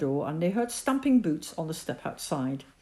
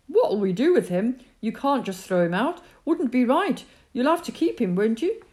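eager insistence; 7 semitones higher, 7 dB louder than usual. A narrator's reading. Boy answers back eagerly.

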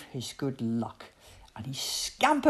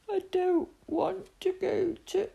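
high, small, drowsy voice; sleepy little tiger; 12 semitones higher than usual. A narrator's reading. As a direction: trail off as if nodding off. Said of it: "sleepily drawing".

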